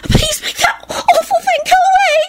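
very high shouted plea; frantic wail. Acted. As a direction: scream for help. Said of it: "wailed frantically".

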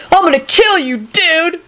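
high-pitched enraged threat; furious bellow. A narrator's reading. Kenny throwing himself at Rosie, bellowing. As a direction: bellow it as you lunge. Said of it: "Kenny bellowed".

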